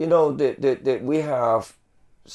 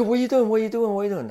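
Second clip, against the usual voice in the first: repeated challenge pitched higher, easing down; alarmed security guard; 10 semitones higher, much faster than usual. Retold or imitated. Security guard confronting a man unloading a bike, alarmed and pitched high. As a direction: hit each question like a guard catching an intruder.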